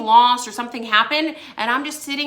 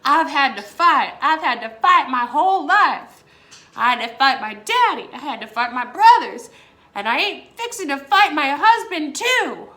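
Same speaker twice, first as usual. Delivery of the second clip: The Color Purple line; 3 semitones higher, 5 dB louder than usual. Retold or imitated. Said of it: "a line from The Color Purple".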